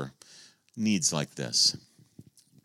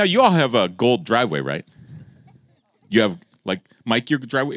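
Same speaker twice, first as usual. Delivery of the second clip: ordinary talk, nothing marked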